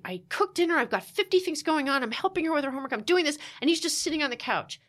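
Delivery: exasperated complaint. Retold or imitated. Wife complains to therapist about dinner and husband, exasperated.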